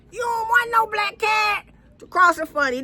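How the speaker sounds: high loud yell to chase it off; angry shout. Real, not acted. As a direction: drive the cat away with a yell. Shouting about cat.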